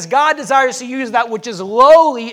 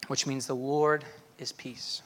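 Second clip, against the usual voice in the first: ordinary delivery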